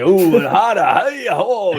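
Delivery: loud, high, slurred rambling whoops; Al Pacino. Acted. Podcaster mocking a possibly drunk awards presenter, loud high whooping impression. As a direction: whoop drunkenly and loudly, stretching the words. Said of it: "He's kinda like".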